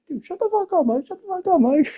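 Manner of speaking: very high, squeaky mock retort; imitated angry retort. Roasted Tim telling Mike to shut up, imitated in a squeaky voice.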